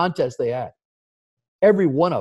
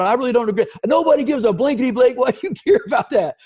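high, pushed-up protest; indignant retort; 8 semitones higher, 4 dB louder than usual. Retold or imitated. Manager protesting to the vice president, indignant. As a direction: argue back, pitched up and a bit louder.

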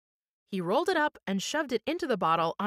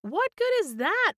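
much higher, loud disbelieving bellow; 10 semitones higher, 4 dB louder than usual. Acted. Blackie bellowing at Christie over the bottle, loud bellow. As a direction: roar the question at him. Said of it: "Blackie bellowed".

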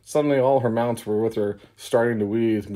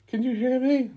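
Letty's high voice. Acted. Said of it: "Letty said".

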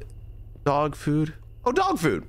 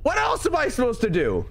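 high-pitched indignant outburst; comic exasperated cry; exasperated protest; 10 semitones higher than usual. Real, not acted. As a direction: protest in disbelief, pitch shooting up. Protesting remark on their pronunciation.

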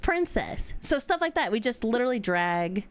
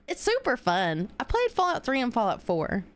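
normal talking voice

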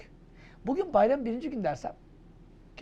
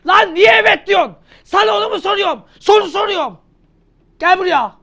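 high, loud hammed-up scolding; mock angry yell; 9 semitones higher, 15 dB louder than usual. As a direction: bawl the student out in mock fury. Teacher scolds imagined student. (abartılı öfkeli bağırış)

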